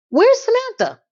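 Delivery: excited question. Real, not acted. Recapper asks about Samantha.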